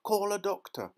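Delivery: high, loud call; urgent shout. A narrator's reading. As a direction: shout for help. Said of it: "shouted Mister Beauregard".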